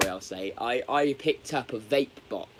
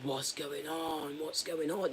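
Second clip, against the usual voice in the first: confused panic; 3 semitones higher, 8 dB quieter, slower than usual. Retold or imitated. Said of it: "I'm like".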